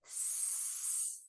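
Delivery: long hissing snake sound; drawn-out s like a snake. Shown as a demonstration. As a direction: hiss like a snake. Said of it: "like a snake".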